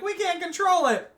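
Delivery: very high, loud mock panic; panicked cry. Staff losing control of the unit, panicked cry.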